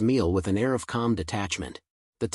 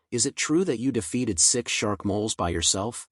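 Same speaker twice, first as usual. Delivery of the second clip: ordinary delivery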